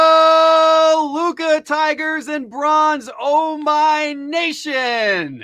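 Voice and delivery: high, loud, stretched-out ring announcer call; custom announcer hello. Pretend. For the hosts, a booming drawn-out announcer hello. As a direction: belt it out like a fight announcer. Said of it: "we'll do a custom hello".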